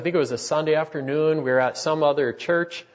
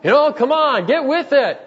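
loud, much higher, exasperated rant at the crying wife, speeding up at the end; angry rant; 10 semitones higher, 8 dB louder than usual. Pretend. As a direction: snap at her in tired fury, getting faster. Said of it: "I could have looked at her and said".

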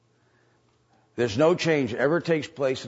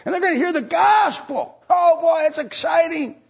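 climbing higher and louder into a shout; excited; 12 semitones higher, 5 dB louder than usual. Acted. Pastor acting out a church member's excitement, building to a shout. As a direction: build the thrill until it bursts out. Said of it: "excited about someone who came to church".

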